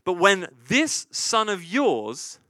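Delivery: "this" dragged out, very slow and biting; bitter stress on this. Retold or imitated. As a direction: sneer the words this son of yours. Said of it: "like, that's a stinger right there".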